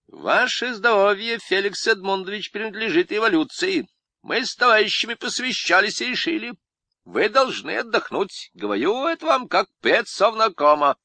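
high wheedling Lenin impression. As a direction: coax like a crafty Lenin. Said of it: "по-ленински".

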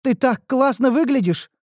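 put-on high, gushing girl's delight; thrilled elf girl greeting her friend. A narrator's reading. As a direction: admire her friend with bubbling joy. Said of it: "восхитилась Неуру".

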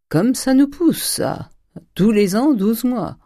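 high, loud, jovial remark; hearty exclamation. A narrator's reading. Notary kissing the birthday girl on both cheeks, hearty. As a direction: exclaim it broadly, pitched well up.

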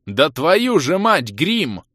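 loud, high, slow exasperated curse; furious yell. A narrator's reading. Mage curses the werewolf while firing, furious yell. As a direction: bellow it, stretching the words in fury. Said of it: "В бешенстве заорал я".

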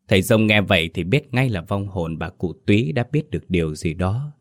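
usual speaking voice

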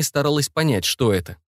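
ordinary delivery

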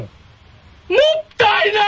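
drawn-out, high wail of dismay; mock dismay. Retelling his shock at the wasted CD space, with a slow high wail. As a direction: stretch it out like a pained cry. Retold reaction to empty CD space.

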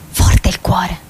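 whispered refrain. Acted. Chorus repeats refrain.

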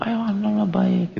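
high, overly cheery neighbour greeting; mock cheery greeting. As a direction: gush a sweet hello to the neighbour. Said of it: "gitu loh". (sapaan manis berlebihan yang dibuat-buat)

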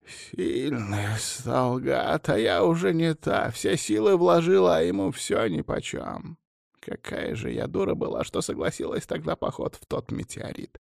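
high, soft, exhausted voice fading to breath; drained lament. A narrator's reading. Collapsed woman regretting the meteorite trip, drained. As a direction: lament weakly with all strength spent.

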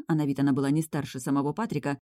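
usual speaking voice

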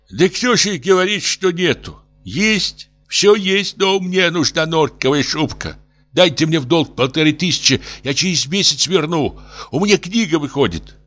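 high, pleading professor voice; distraught tipsy professor. Acted. Professor begs for a loan for a fur coat, high pleading.

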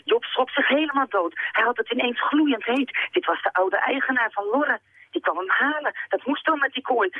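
read-aloud narration, pitch climbing; agitated story narration. A narrator's reading. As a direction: narrate from inside the indignant boy. Narrates the boy's alarm and resentment.

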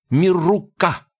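high goblin gibberish; boasting goblin. Acted.

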